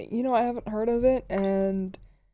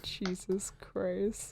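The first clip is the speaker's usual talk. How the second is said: slow whispered exasperation; 14 dB quieter, much slower than usual. Real, not acted. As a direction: breathe it under your breath. Exasperated aside.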